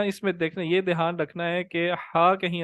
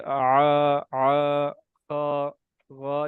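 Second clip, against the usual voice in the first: mock guttural aa, kha, gha sounds from the throat; lightly hammed imitation of throat practice; imitating throat drills; much slower than usual. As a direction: copy the odd throat noises foreign students made. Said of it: "ساتھ ساتھ آوازیں نکال رہے ہوتے تھے".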